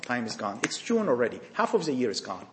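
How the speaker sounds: dropped low and soft, time slipping away; quiet, grave. Acted. Preacher warning youth, low and hushed. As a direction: let it sink, hushed and heavy. Lament over lost time.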